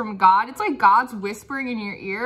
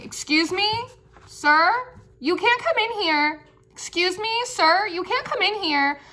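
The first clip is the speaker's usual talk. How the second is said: higher, prim lady turning someone away; a polite but firm doorkeeper lady; higher prim lady saying you can't come in; lady's voice; 7 semitones higher than usual. Pretend. Channelled lady ordering someone out.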